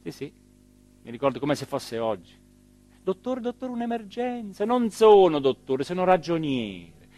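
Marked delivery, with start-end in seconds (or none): 3.0-4.7 much higher, urgent plea for the doctor; panicked caller. Retold or imitated. Night caller begging the mayor. 4.7-7.1 irritated retort. Retold or imitated. Mayor's irritated retort to caller.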